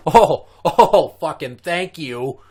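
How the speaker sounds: loud, high bursts of mock-ecstatic gratitude; exaggerated, high-pitched relieved outburst; exclaimed relief. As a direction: cry out with relief, then stretch the thanks. Retold huge relief at the news.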